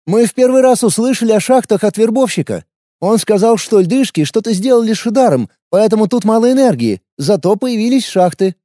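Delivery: wary fighter, blunt and guarded; young warrior woman. A narrator's reading.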